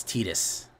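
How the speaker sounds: Titus said as TEE-dus; corrected pronunciation. Shown as a demonstration. Reading out a correction of a game name, Titus as TEE-dus. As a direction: say Titus with an "ee" in the first syllable. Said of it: "everyone gets mad at me for pronouncing".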